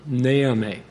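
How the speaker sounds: "Naomi" as NAY-uh-mee, first syllable stressed. Shown as a demonstration. Pastor repeating his wife's name, stressed on NAY. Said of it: "with the emphasis on the first syllable".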